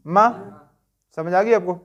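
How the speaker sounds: ordinary talk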